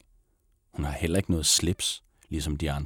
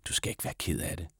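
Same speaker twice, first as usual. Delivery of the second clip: soft unvoiced whisper of comfort; 7 dB quieter, faster than usual. A narrator's reading. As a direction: whisper it kindly to a scared new girl. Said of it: "Så hvisker hun til Sita". (hviskende, trøstende)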